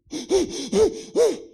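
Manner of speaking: acted panicked gasp; mock gasp. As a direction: gasp as if choking in smoke. Acting out worse air hunger.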